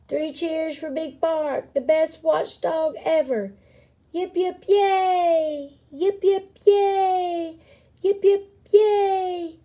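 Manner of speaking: high, chanted three cheers; cheering. A narrator's reading. Crowd gives three cheers.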